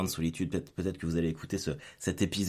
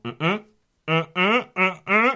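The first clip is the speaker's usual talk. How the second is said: insistent throat-clearing; 11 semitones higher, 9 dB louder than usual. Retold or imitated.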